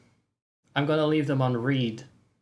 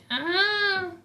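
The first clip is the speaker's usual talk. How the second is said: cutesy squeal. Acted. Cutesy squeal in goodbye bit.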